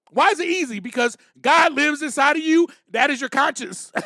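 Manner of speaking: rising sermon voice; preaching. Real, not acted. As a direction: preach it to the room. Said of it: "Don't make me start preaching up here".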